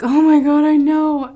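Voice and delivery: high, loud retold outburst; loud exclamation. Retelling her own reaction to him, loud exclamation.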